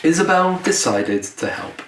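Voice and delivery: plain reading voice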